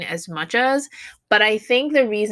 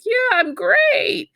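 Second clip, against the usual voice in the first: much higher and slow, mock boastful; smug joke; 3 dB louder, slower than usual. Shown as a demonstration. Teacher jokes about a cocky answer to praise, mock smug. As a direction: play the cocky reply for a laugh. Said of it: "how would you respond".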